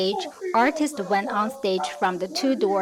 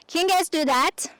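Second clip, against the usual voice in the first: normal talking voice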